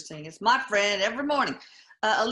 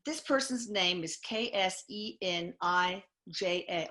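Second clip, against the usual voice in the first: ordinary talk, nothing marked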